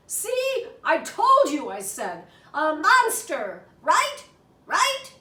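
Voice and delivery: high, loud told-you-so; triumphant. Acted. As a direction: crow that you were right. Child triumphant, mom sees monster.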